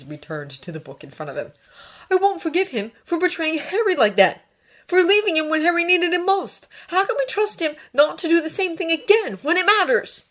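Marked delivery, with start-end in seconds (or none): 2.1-10.2 high, loud, impassioned tirade; angry Draco. A narrator's reading. Draco refusing to forgive Ron, impassioned. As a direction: build a heated case against him.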